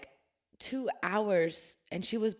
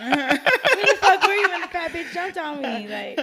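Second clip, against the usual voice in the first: much higher, louder, laughing through the words; half-yell it while cracking up; 11 semitones higher, 14 dB louder than usual. Real, not acted. Laughing while telling scare.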